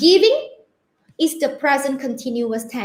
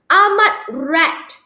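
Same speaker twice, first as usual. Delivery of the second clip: normal talking voice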